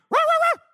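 three high, loud yaps; squeaky mock puppy barking; imitated dog barking. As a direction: bark back excitedly like a small dog.

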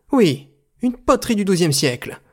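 high, insistent director's voice; emphatic exclamation. A narrator's reading. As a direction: insist excitedly to a disbelieving listener. Director insists to incredulous Jean. (exclamation insistante, voix haute)

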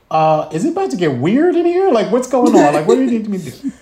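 high-pitched, rising bewilderment; bewildered questioning. Retold or imitated. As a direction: act out mounting confusion. Bewildered protest in mocap suit.

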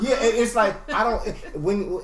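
high, laughing, slowed start. Real, not acted. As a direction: talk through rising laughter. Laughing while explaining.

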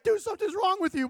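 high, mock-whining complaint; distressed protest. Retold protest while puking.

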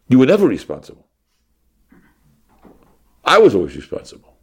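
loud, emphatic back-and-forth; acted retort. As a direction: argue it out loudly, in play. Acted spousal dispute over responsibility.

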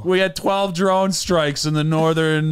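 mock weather report read; news anchor. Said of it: "news shows have".

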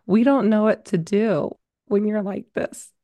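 a light take on a baffled father; imitating dad. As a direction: quote dad's words slowly, as if at a loss. Quoting dad in the bedroom.

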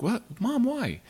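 high, startled question; a son caught off guard by his mom; surprised. Retold or imitated. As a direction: jump up in disbelief. Shocked reply to mom.

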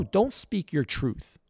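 usual speaking voice

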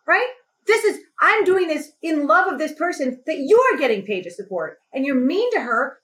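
high, pleading, indignant; upset outburst. Retold or imitated. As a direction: plead with outrage, voice breaking. Retold tearful outburst at manager.

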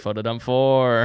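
loud, slow mock announcement; mock-grand punchline; teasing imitation. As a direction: belt it out like a grand title. Teases friend's post titles.

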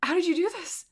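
high-pitched, overwhelmed questioning; acted sobbing. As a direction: ask in a high voice, as if through tears.

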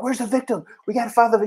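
high-pitched frantic imitation of himself searching; jacked-up urgency. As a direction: re-enact an amped-up, urgent demand. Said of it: "I'm all".

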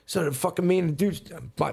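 ordinary talk, nothing marked

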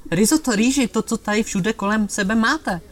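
annoyed guest insisting on rice; exasperated outburst. Acted. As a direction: snap at the waiter in disbelief. Hungry guest loses patience.

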